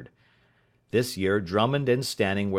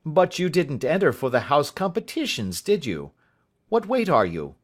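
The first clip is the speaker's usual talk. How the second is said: higher, lightly voiced hearty schoolmaster; a hint of a flustered genial master; 6 semitones higher, 5 dB louder than usual. A narrator's reading. Master questioning the boy.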